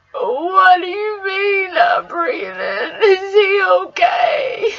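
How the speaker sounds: real crying, words pulled out slowly. Real, not acted. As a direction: disbelief breaking into sobs. Hearing the dog is dead.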